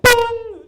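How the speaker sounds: loud, high electronic-style tone; sustained mock intercom beep; imitated PA chime. Comic imitating U S pool speaker, high loud beep.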